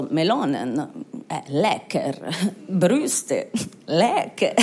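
low voice, savouring each word; mock Italian voters. Italian voters reacting to the melons, low mock savouring. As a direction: chew over each word slowly, mock-appreciative. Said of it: "die Italiener waren so".

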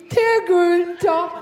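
slow, stretched hearty hello to a mate; a man hailing Günther across the loo; drawn-out greeting. Retold or imitated. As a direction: stretch the name out like greeting an old pal. Man calling buddy at urinal.

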